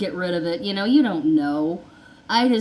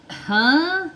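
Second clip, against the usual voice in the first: a sudden high, confused huh; high startled huh; 10 semitones higher, 3 dB louder than usual. Retold or imitated. Patient stunned by the doctor's news, high startled huh. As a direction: catch the shock in one high huh.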